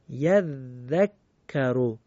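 slow recitation of یذکرو with both shaddas held; doubled letters stressed. Shown as a demonstration. Teacher demonstrating shadda to students, held doubled consonants. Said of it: "مثل".

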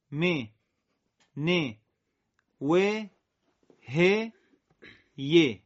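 each letter with a long Arabic "ee", بی تی ری; teacher drilling letters with a long ee vowel; Arabic kasra. Shown as a demonstration. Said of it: "با لهجهٔ عربی قرائت بکنیم".

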